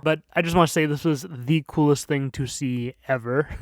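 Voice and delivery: ordinary delivery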